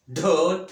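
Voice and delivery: ढोल with a heavy, loud, breathy ढ; heavy sound. Shown as a demonstration. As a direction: boom out ढोल heavily. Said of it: "ऐसे भारी आवाज़". (भारी आवाज़ में ढोल)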